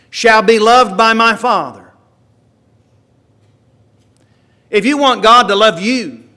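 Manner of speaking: loud, high preaching, building through the verses; fervent preaching. Acted. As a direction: proclaim it to the whole church. Preacher reading scripture, rising.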